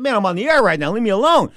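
loud, high-pitched brush-off; irritated outburst. Retold or imitated. TV host to promoter while on the air, loudly annoyed. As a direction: snap loudly at someone interrupting you.

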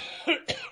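short hard cough; coughing fit. Real, not acted. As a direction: clear the throat with a cough. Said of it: "I'm, um, coughing up here".

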